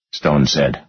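plain reading voice

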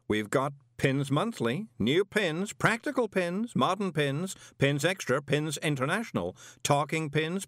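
high, eager salesman rattling off titles; big shopkeeper voice. A narrator's reading.

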